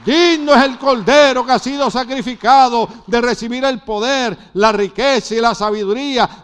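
proclamación fervorosa y sostenida; prédica enérgica. Real, not acted. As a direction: predicar con fuerza, enumerando con ímpetu. Predica a plena voz. (sermón a voz alzada)

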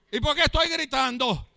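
full-force pulpit proclamation; fiery preaching. Acted. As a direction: proclaim it at the top of the voice.

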